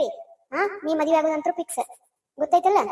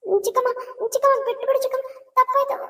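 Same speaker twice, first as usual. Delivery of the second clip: high, pleading cry for mercy; 9 semitones higher than usual. Acted. Girl begs her stepmother aunt to stop, pleading high.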